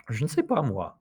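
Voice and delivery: light "Je", phrase falling onto "moi"; stress on moi. Shown as a demonstration. Teacher repeating the French emphasis, stress on "moi". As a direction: keep "Je" small and finish on "moi". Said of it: "the moi gets emphasized".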